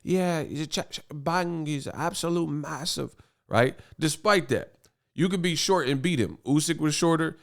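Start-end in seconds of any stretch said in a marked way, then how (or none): 0.0-3.1 mock British accent, quieter and pitched up; British TV pundit. Boxing YouTuber mimicking British TV talk about a massive fighter, mock British accent. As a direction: put on a matey British accent. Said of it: "in the British T V".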